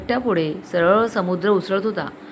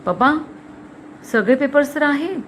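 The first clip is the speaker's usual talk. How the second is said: higher, uneasy daughter's voice; anxious protest; 6 semitones higher than usual. A narrator's reading. Daughter tells father all the papers are there, anxious. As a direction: protest with rising worry.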